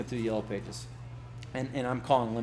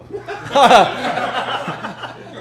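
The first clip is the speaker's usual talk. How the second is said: big laugh; 11 semitones higher, 13 dB louder than usual. Retold or imitated. Laughing while retelling refusal.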